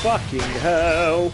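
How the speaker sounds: loud, slow drawn-out swear; shouted swear. Real, not acted. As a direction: yell it out in shock. Player reacts to game shock.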